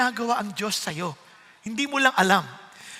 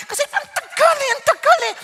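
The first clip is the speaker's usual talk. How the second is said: higher, laughing mock whine; 13 semitones higher, 5 dB louder than usual. Pastor teasing impatient wifi users, laughing high whine.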